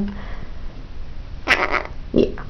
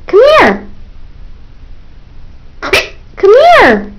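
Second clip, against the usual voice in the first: high sing-song demo; 11 semitones higher than usual. Shown as a demonstration.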